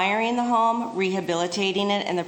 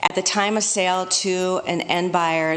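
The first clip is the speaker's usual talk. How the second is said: normal talking voice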